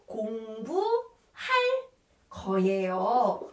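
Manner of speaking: "공부할 거예요" slowly, stretched and split; teacher sounding out "공부할 거예요" in pieces; drawn-out demonstration. Shown as a demonstration. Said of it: "We have to put 리을 거예요".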